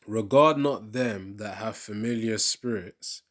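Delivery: slow, loud preaching read; scripture reading. Acted. Preacher reading Leviticus to his viewers, slow loud proclamation. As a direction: proclaim each verse slowly like a pulpit reading.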